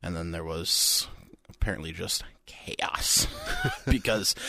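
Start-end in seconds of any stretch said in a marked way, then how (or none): 3.3-3.8 short high laugh. Real, not acted. Laughing at the chaos.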